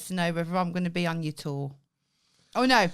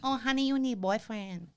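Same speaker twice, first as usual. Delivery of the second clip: a hint of a teasing Thai accent; 4 semitones higher, 5 dB quieter than usual. Wife teases fans in a light Thai accent. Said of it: "asking me to do my Thai accent".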